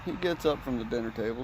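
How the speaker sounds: ordinary delivery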